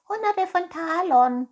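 high, loud cat echo; murmuring cat. Acted. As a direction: parrot it greedily in a high cat voice. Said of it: "murmelte die Katze".

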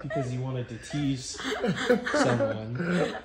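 chuckling through the words, slowed; laughing. Real, not acted. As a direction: talk while laughing at a secret. Doctor laughs while hinting.

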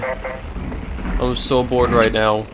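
bored drawl. Shown as a demonstration.

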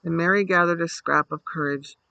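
usual speaking voice

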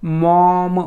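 मॉम stretched on an open "aw"; slow sounding-out. Shown as a demonstration. Teacher modelling the word for students, मॉम said slowly with open O. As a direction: draw out मॉम, releasing the last m. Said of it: "असा उच्चार करतोय".

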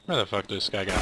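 ordinary talk

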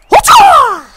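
high, loud victory yelp; excited shout. Real, not acted. Gamer cheering his own goal, high loud yelp. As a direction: blurt it out with a burst of triumph.

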